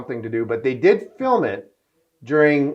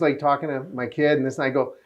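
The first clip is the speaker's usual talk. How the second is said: ordinary delivery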